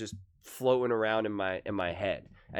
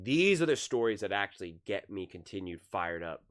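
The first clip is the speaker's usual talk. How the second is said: usual speaking voice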